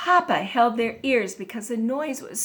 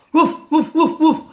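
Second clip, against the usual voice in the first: short, loud, higher-pitched woofs; dog barking; 5 semitones higher, 8 dB louder than usual. A narrator's reading. Dog barks at the squeaky bed, loud woofs. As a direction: bark it out sharply like a dog. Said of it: "barked the dog".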